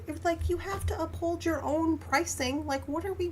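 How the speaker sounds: ordinary talk, nothing marked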